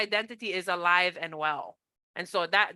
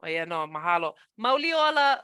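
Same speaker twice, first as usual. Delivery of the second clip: ordinary talk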